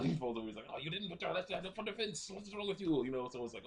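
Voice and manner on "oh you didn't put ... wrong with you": high-pitched, lightly chiding boxing coach; mock scolding. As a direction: chide a pupil who dropped their guard. Boxing teacher scolds fallen pupil.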